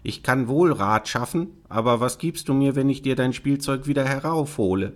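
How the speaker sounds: bargaining frog. Acted. Frog offering the princess help for a price, bargaining. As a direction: play a frog offering a deal.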